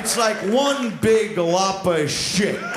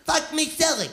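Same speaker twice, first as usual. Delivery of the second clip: mock scream; 10 semitones higher than usual. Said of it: "she's screaming".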